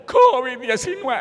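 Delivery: high, loud, drawn-out horror wail; hammed-up panicked cry; mock desperate dread. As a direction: howl the name as if it were a monster. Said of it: "él desesperado. Ante el nombre".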